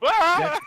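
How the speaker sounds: short high-pitched "ah"; mock scream. Player tossed like a snow globe, mock scream. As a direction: cry out as if shaken about.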